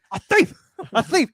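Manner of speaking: loud high newsroom rant; Jameson shout. Retold or imitated. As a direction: bark it like an angry editor.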